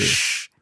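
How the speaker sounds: Sh whispered, tongue forward. Shown as a demonstration. Teacher modelling the sh sound. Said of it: "the sh is more kind of forward".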